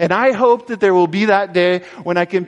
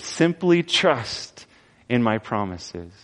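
very slow and soft, tapering away; gentle invitation; 5 semitones lower, 5 dB quieter, slower than usual. Acted. Jesus gently asking his followers to trust, slow and soft. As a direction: invite them tenderly to trust.

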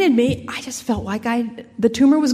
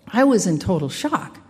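ordinary talk

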